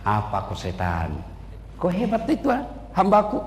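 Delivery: a hint of a solemn God; God's voice. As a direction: speak down calmly as God. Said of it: "Kata Allah".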